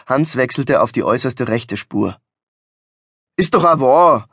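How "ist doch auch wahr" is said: indignant, punched-up retort; grumpy scolding. Acted. Driver carries on cursing other motorists, grumpy scolding. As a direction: keep griping, louder on the last word. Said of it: "fuhr er zu schimpfen fort".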